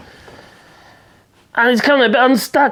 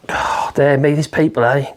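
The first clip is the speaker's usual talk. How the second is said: weary sigh; 7 semitones lower than usual. Real, not acted. Exasperated sigh at the reader.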